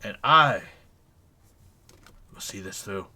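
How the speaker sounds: loud, self-important tirade, dropping to a cold close; pompous furious gentleman. Acted. Sir Henley Ricker berating the worker, loud and pompous. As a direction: lecture the lazy oaf, then announce your name with pride.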